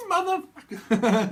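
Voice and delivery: slow, high, crying yelp of a pop singer; mock pop-star yelp. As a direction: stretch it out like a stage yelp.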